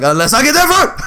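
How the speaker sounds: laughing playful taunt. Retold or imitated. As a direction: tease it through a laugh.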